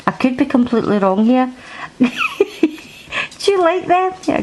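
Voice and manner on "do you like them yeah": high coaxing question to a pet. Real, not acted. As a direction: ask the cat in a lifted coo. Asking cat in high voice.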